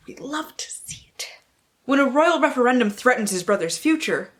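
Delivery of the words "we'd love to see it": whisper. Real, not acted. Gleeful aside.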